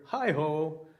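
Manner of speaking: loud high hello from outside; calling out. Acted. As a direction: call it across the doorway. Hare calls from the door.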